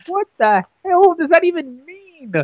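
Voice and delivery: high, slow, baffled what the hell; lightly hammed-up disbelief at the director; baffled question. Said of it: "looked at the director and said".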